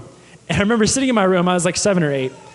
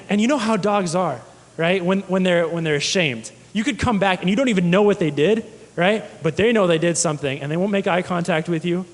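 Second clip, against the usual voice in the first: usual speaking voice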